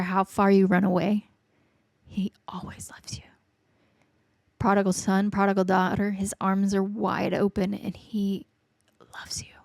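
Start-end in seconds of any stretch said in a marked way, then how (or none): 2.0-3.2 whisper. Real, not acted. Tender sermon on God's love. 7.7-9.5 whisper. Real, not acted. Tender sermon on God's love.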